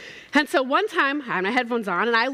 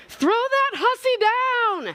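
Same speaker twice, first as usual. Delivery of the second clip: much higher, slow laughing mock yell; 11 semitones higher, much slower than usual. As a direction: call up the tower half laughing, stretching each word. He yells up to servants.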